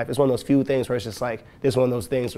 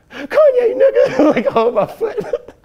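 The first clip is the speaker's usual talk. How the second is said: high crying wail, much higher and louder, breaking into laughs; crying whine; 10 dB louder, much slower than usual. Retold or imitated. Boy crying over his hurt toe. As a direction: wail it through tears and laughs. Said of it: "I was just sitting down crying".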